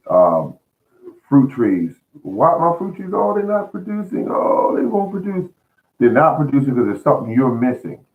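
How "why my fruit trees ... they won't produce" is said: high, whining mock complaint; whiny gardener complaint. Gardener moaning that fruit trees won't produce, high whine. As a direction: play the fretting gardener, voice pitched up.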